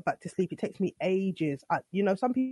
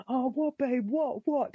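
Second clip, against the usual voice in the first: higher, confused half-asleep voice; groggy husband; 5 semitones higher, slower than usual. Retold or imitated. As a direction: sound just woken and lost. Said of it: "That's what you're saying to me".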